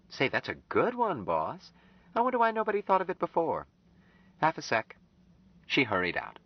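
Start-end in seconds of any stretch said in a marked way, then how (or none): 0.1-5.0 put-on much higher, playful young woman's voice; young woman, mock admiring; teasing Anne. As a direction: tease the boss brightly.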